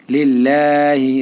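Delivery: লিল্লাহি with a light, thin lam, said slowly. Shown as a demonstration. Teacher showing students a thin lam in লিল্লাহি, slow and light. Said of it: "পাতলা করে পড়তে হবে".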